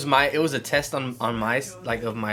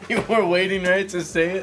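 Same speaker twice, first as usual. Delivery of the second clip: laughing; 8 semitones higher, 5 dB louder than usual. Real, not acted. Teasing through laughter.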